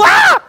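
very high, loud acted shriek; startled scream. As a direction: jump with a sudden yell of fright. Acted disciples' fright at the voice.

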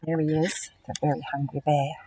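ordinary delivery

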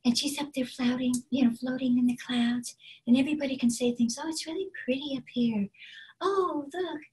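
high, airy, gentle voice; very breathy character. Shown as a demonstration. Teacher as sweet character up in the clouds, high breathy voice. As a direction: float dreamily in the clouds. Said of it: "is very breathy".

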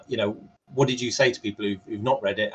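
ordinary talk, nothing marked

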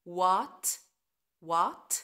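what said wat, no H; silent H. Shown as a demonstration. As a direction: drop the H in What. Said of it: "ال H silent".

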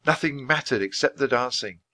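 usual speaking voice

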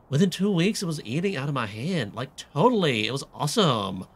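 put-on emphatic valley girl, leaning hard on the words; punchy, hammed-up teen-girl read. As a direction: gush like a pretentious teenage girl. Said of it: "this does not sound like a valley girl".

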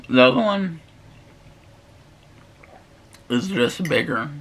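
eating between words; talking while chewing. Real, not acted. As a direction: mouth partly full of pasta. Seen 0: bite taken at -1.0, jaw and cheeks chewing while talking after.

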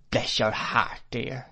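usual speaking voice

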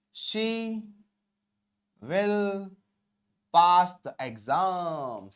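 very slow, word-by-word model reading; slow dictation. Shown as a demonstration. Teacher completing the English translation for learners, slow dictation. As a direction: draw each word right out for the class.

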